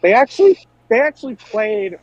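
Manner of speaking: sudden high, loud burst of disbelief; excited disbelief. Real, not acted. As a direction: blurt it out, amazed it really happened. Shocked recalling train sound effect.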